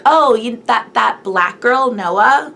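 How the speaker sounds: single her out with a slow mock question; mocking schoolgirls. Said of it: "would be like".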